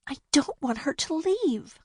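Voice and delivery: sad plea. A narrator's reading. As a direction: say it as if near tears. Bea sad about Winnie.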